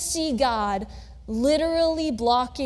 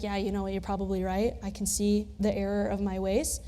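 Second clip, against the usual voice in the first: sheepish admission; 6 semitones lower, 6 dB quieter than usual. Retold or imitated. Balaam sheepishly concedes.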